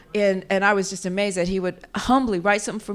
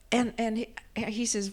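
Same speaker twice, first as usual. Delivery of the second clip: ordinary delivery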